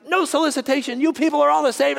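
high-pitched angry outburst in a put-on voice; mock livid rant. Livid resident turns away evangelists, mock high ranting. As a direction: imitate an irate man shouting visitors away. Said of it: "he's livid again".

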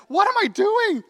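high, loud self-reproach; hammed-up incredulous yell; exasperated. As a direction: act out catching yourself, exasperated. Voicing exasperation at one's bad day.

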